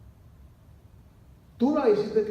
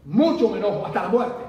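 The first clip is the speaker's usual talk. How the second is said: respuesta enojada, mucho más fuerte; un profeta terco que insiste en su enojo; Jonás enojado; 9 dB louder, faster than usual. Acted. (Jonás enojado, fuerte)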